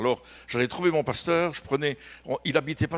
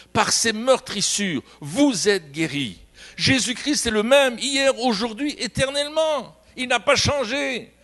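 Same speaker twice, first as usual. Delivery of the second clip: rising, louder preacher's cry of faith; fervent preaching; 7 semitones higher, 4 dB louder, slower than usual. Acted. Preacher proclaiming healing to the congregation, fervent and loud. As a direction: proclaim it slow and high to the church.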